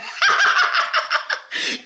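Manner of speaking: high airy burst of laughter; high breathless laugh. Real, not acted. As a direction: let out a squeaky, silent giggle. Laughs at her own pause.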